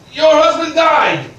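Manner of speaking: slow, loud, high shout. Acted. Creditor shouting at the widow about her husband, loud. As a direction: bellow each word at her.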